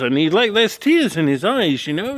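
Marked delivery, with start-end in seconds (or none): none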